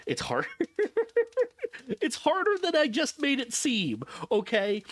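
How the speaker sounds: ordinary talk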